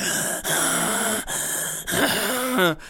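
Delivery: gasping breath, falling; mock labored gasping; imitated heavy gasp. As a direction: heave in air like Rocky. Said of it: "she's breathing like".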